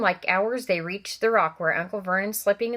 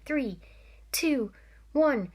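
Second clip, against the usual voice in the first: from hushed to rising, tense count; rising countdown; 6 semitones higher, much slower than usual. A narrator's reading. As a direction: build the tension number by number. Building countdown to boom.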